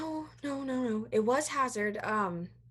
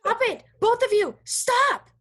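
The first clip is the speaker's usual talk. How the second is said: raised, high and loud plea to stop; exasperated order; 7 semitones higher, 5 dB louder, slower than usual. Acted. Friend breaking up two people fighting, exasperated shout. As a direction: cut through the argument, loud and exasperated.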